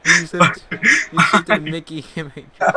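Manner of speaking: laughing through a loud accusation. Real, not acted. Friend accusing him through laughter, loud and laughing. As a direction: blurt it out while cracking up.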